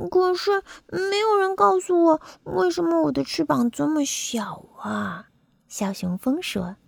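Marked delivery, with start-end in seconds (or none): 0.1-5.3 put-on high child-creature voice, easing down; puzzled little bumblebee. Bumblebee asks parents about small wings, high and puzzled.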